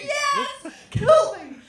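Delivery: high, loud ecstatic yell with a laugh; excited man shouting. Retold or imitated. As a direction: cheer like the one man who loves it. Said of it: "one excited man in the corner just going".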